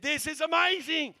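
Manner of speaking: high, loud, drawn-out thrill; amazed. Retold or imitated. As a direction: imitate someone overwhelmed by a gift, slow and high. Retold pastor thrilled by car gift.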